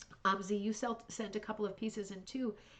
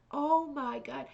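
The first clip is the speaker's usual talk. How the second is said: mock loud whisper, higher and louder; imitated loud whisper; 7 semitones higher, 5 dB louder, slower than usual. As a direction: imitate someone failing badly at whispering. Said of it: "his whisper is like".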